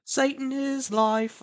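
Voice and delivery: put-on high, slow eerie little girl voice; high sinister child singsong; creepy kid song. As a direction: chant it like a haunted child. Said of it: "doing little kid songs".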